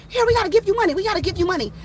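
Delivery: high-pitched, eager neighbors; imitated excited insistence. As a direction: act out people rushing up to insist.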